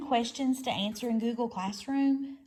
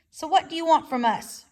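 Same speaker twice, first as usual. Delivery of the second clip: growl; 5 semitones higher, 7 dB louder than usual. A narrator's reading. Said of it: "growled Fink".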